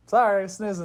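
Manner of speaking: mock sleepy voice. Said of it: "And she's like".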